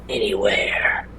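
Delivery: barely voiced, strained whisper; struggling to speak. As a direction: force out a first word after ages of silence. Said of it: "he's gonna struggle to produce sound".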